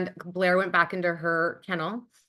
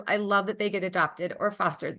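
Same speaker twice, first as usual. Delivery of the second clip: ordinary delivery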